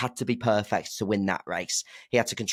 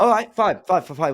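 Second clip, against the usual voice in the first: exasperated giving in; 9 semitones higher, 6 dB louder than usual. Real, not acted. Caving in after the argument.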